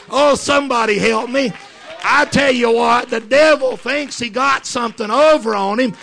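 high, loud, ringing sermon climax; swelling revival preaching with shouted hallelujahs; full-force preaching. Acted.

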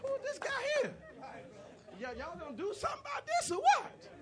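very high, loud, incredulous voice; indignant challenge. Acted. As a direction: call out the giant in high-pitched outrage. David challenges Goliath in story.

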